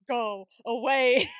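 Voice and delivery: loud, long-drawn exasperation; hammed-up begging, slow and loud; exasperated plea. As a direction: stretch each word out, fed up. Acted plea to an annoying character.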